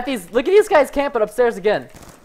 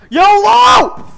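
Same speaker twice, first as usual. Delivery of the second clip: long, high, very loud yell; reckless battle cry; 14 semitones higher, 11 dB louder than usual. Real, not acted. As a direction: yell it as you charge in. Reckless charge.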